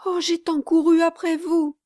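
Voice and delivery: high, breathless reassurance; panting young girl. Acted. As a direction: still out of breath from running. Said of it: "la messagère encore haletante".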